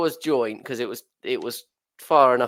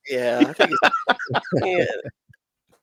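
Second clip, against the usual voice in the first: laughing; 5 semitones higher, slower than usual. Real, not acted. Laughing at a joke.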